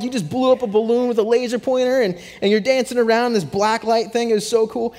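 retelling his thrill at the laser show, high and gushing; all excited. Retold or imitated. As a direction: rave like a kid who just saw magic. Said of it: "and I'm all excited".

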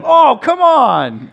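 dad complaining at a penalty on the TV; fed-up fan. Retold or imitated. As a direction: moan at the referee's call. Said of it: "Whenever there's a penalty called on my team".

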